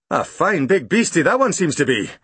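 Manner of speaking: pleased constable; london policeman. Acted. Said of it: "said the policeman".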